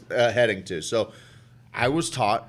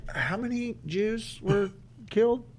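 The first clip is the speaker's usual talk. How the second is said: mock quiz; 7 semitones higher, 13 dB quieter than usual. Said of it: "And you're like".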